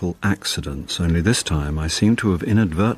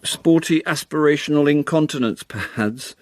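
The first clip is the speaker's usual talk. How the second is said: sportier; 6 semitones higher than usual. Shown as a demonstration. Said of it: "yeah, sportier, aspirational".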